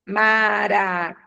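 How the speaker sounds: slow "maracujá", each syllable stretched to a long JÁ; stress on JÁ. Shown as a demonstration. Teacher showing an accented oxytone, final JÁ drawn out. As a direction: sound out "maracujá", landing on the final JÁ. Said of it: "Então, eu acentuo".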